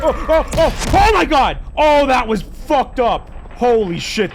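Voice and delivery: loud, high panicked oh my god; real startled shout after near death; shocked yelling. Real, not acted. Nearly killed in game, shocked.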